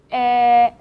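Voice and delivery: "Eh" said open, like the e in bed; a short, open "Eh". Shown as a demonstration. Teacher showing learners the bed vowel, clearly sounded. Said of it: "eh in English word bed".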